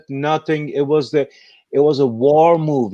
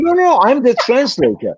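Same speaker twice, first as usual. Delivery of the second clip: put-on high, louder insistent kid voice; high-pitched eager young man; imitating the eager kid; 10 semitones higher, 5 dB louder than usual. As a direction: mimic the young translator pressing his point. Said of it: "He said".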